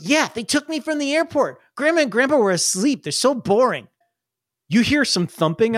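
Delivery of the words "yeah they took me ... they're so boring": put-on higher, breezy little-boy explanation; chatty kid. Kid telling brother about the airport, higher chatty voice. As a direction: explain it all like an excited kid. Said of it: "And he goes".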